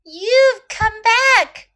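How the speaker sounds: high, loud, stretched-out exclamation; big storybook shout of welcome; overjoyed cry. A narrator's reading. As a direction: cry it out slowly with delight. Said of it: "cried Chloe".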